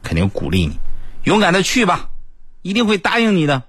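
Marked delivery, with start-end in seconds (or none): 1.2-3.6 put-on encouraging girl. Girl encourages shy guy.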